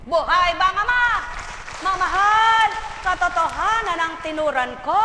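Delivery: loud, high rallying cry; preacher's call. Real, not acted. Preacher asks the congregation, loud and rousing. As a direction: call it out to the hall.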